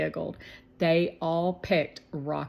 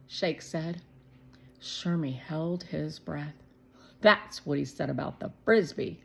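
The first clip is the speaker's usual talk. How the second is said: ordinary delivery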